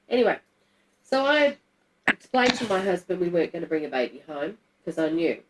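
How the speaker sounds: ordinary delivery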